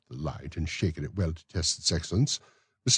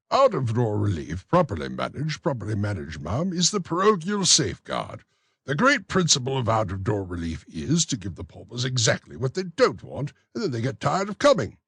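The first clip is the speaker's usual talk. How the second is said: a higher, self-important parish beadle, louder; pompous beadle voice; 6 semitones higher, 4 dB louder than usual. Acted. Beadle to the matron, pompous higher voice. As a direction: lecture the matron grandly on the weather.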